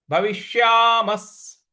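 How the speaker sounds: भविष्यामस् ending in a clear स sound; slow भविष्यामस् with a final s; visarga said as s. Shown as a demonstration. Teacher slowly models भविष्यामस् with the visarga as s. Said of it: "उसका उच्चारण हमें स ले आना है".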